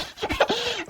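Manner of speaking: high burst of giggling; burst of laughter. Real, not acted. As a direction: break into laughter. Laugh breaks the reading.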